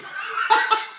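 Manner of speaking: high, loud cackling laugh; real helpless giggle burst. Real, not acted. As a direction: crack up at your own joke. Comic cracks up at own joke.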